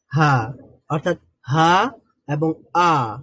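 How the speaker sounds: হা and আ drawn out slowly and breathy from the throat; slow letter demo. Shown as a demonstration. Teacher slowly modelling throat letters. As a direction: stretch out হা and আ, airy from the throat. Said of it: "হলকের শুরু থেকে উচ্চারিত হয়".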